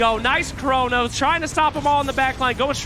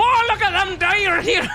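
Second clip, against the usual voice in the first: high-pitched Southern yokel holler; excitable mock Southern accent; Southern drawl; 9 semitones higher, 4 dB louder than usual. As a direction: hoot it out in a hammed-up Southern drawl. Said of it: "I want that southern drawl".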